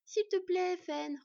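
high, eager begging; pleading child. A narrator's reading. Sister joins in begging Fenn, pleading. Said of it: "renchérit sa sœur".

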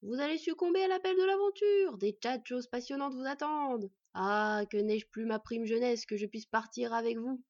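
big, loud, rousing send-off; enthusiastic. Acted. Host envying guests their adventure, enthusiastic. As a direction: urge them on grandly, with relish. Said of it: "avec enthousiasme".

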